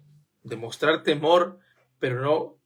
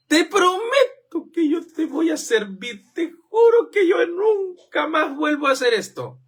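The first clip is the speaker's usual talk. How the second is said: higher, hammed-up sobbing promise; mock tearful vow; 11 semitones higher, 4 dB louder than usual. Preacher mocking a believer's promise to God, hammed-up crying. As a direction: plead through fake tears.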